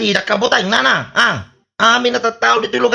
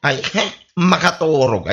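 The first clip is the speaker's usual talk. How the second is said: normal talking voice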